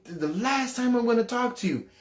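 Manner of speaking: high-pitched final threat, acted; desperate ultimatum. Doubting teenager warning the Lord, desperate. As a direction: cry it out as a last warning.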